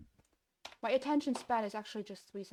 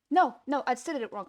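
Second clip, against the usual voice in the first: louder, higher cry of frustration; dismayed outburst; 3 semitones higher, 9 dB louder, faster than usual. Real, not acted. Coder spotting his own bug, loud dismay. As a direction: blurt it out in dismay.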